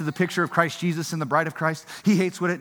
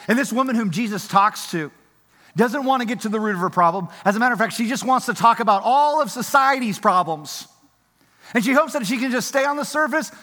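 rising preaching; 5 semitones higher, 4 dB louder than usual. Acted. Heated preaching.

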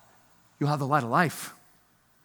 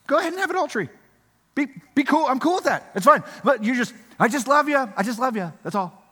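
mock lax Jesus; 8 semitones higher, 3 dB louder, faster than usual. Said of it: "Jesus certainly can't be saying".

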